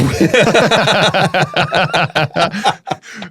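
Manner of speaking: long, high burst of laughter; real sustained laugh. Real, not acted. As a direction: crack up helplessly. Laughing at his remembered fear.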